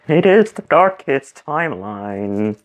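slow, drawn-out mock pronouncement; playful intoning. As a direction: stretch it out theatrically. Joins child's intoned meme exchange.

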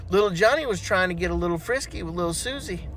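ordinary talk, nothing marked

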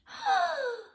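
high startled gasp. Acted. The veggies gasping at the scary torchlight, high. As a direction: gasp sharply in fright. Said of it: "Everyone gasped".

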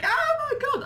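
high squeal of disbelief; starstruck squeal. Retold or imitated. A fan recognises her, she squeals with delight. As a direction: gasp it out, way up high. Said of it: "And I was like".

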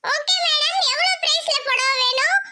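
saree shop assistant. Acted.

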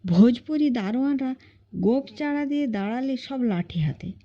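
plain reading voice

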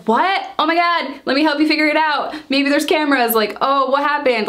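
a lightly voiced, fussing, helpful sister; imagined concerned sister. Imagined caring reaction from sister.

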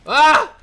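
sudden loud high shriek; shocked scream. Real, not acted. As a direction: yelp in shock.

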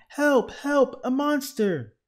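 high, loud cries for help; storybook scream of alarm; panicked shout. A narrator's reading. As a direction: yell for rescue at the top of the pitch. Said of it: "shouted Baby".

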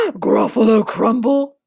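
loud gasp, then monster disbelief; aghast storybook monster; shocked Gruffalo. Acted. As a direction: gasp and repeat it in horror.

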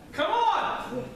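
a quick high call, lightly voiced as the ghost; a hint of a ghost calling out; mock ghost. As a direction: toss it off like a spooky ghost beckoning. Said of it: "what would the ghost have said?".

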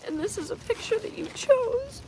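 high, tearful voice; choked up. Real, not acted. Showing viewers a picture someone chose, choked up. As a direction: squeeze the words out through real tears. Moved by a memorial gift.

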